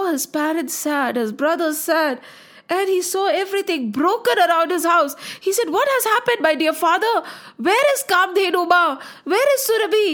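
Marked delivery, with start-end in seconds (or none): 5.7-10.1 alarmed questioning. A narrator's reading. Son finds home broken, asks anxiously.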